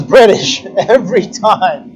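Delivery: Australian attempt slipping into British accent; exaggerated, playful British accent. Shown as a demonstration. Speaker showing a failed Australian attempt, British accent. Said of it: "I try to do an Australian accent".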